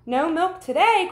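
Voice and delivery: high, loud dismayed cry. Acted. Farmer reading the strike note, high loud cry. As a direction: wail it in dismay. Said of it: "cried Farmer Brown".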